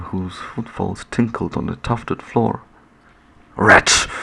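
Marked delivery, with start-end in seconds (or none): none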